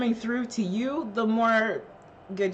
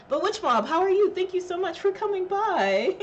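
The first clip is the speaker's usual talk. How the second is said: excited greeting; 11 semitones higher than usual. Real, not acted. Greeting a new chatter on stream.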